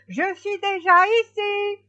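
very high, loud call; hedgehog calling out. A narrator's reading. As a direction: shout it across the field. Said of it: "le hérisson lui cria". (cri fort et très aigu du hérisson)

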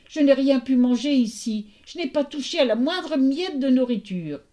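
high, mildly tearful protest; storybook girl's crying voice, lightly acted. A narrator's reading. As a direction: plead innocence on the verge of tears. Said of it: "Perséphone se mit à pleurer".

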